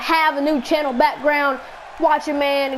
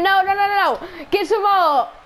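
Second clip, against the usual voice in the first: excited; 6 semitones higher than usual. Real, not acted. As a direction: cry out as the pin nearly lands. Excited play-by-play of a near fall.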